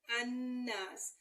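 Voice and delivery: अन्नास drawn out, held nn; slow doubled noon. Shown as a demonstration. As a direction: linger on the nn in अन्नास. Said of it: "सीन साकिन".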